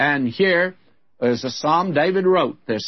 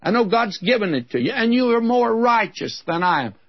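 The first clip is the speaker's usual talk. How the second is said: much higher, pleading Saul voice, rushing on the middle line; 8 semitones higher than usual. Retold or imitated. Saul conceding to David who spared his life, high and pleading. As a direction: give Saul's words high and earnest, pressing the point. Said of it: "he said".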